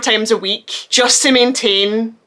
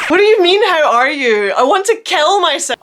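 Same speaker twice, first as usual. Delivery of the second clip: exasperated outburst; 6 semitones higher than usual. Real, not acted. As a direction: blurt it out, fed up. Outburst about being asked how are you.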